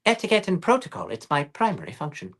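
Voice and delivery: high, quick posh British droid; prim protocol robot, pitched well up; C-3PO. Acted. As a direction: recite it as a fussy British butler-robot. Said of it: "funny thing about three P O".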